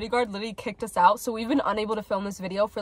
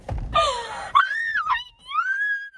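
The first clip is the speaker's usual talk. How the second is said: very high, long scream of delight; swooning fangirl shriek; delighted squeal. Real, not acted. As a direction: squeal that keeps going. Squeal at romantic quote.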